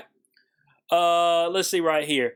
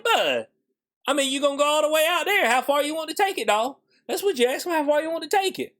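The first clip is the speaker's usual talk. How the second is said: higher, fast coaxing challenge; high teasing taunt to a stubborn friend; mock pep talk; 7 semitones higher than usual. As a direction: egg them on, half joking.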